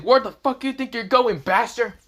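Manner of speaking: high, pushed-up taunting yell; acted heated call-out; angry challenge. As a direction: yell it high at a fleeing enemy. Character confronts the fleeing fallen angel.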